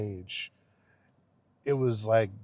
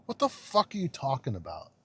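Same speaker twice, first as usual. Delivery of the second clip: ordinary delivery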